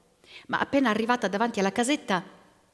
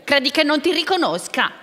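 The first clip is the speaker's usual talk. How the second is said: higher, louder indignant question; old man's raised, scornful retort; indignant challenge; 6 semitones higher, 5 dB louder than usual. A narrator's reading. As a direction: throw it at the ogre defiantly. Old man defies the ogre.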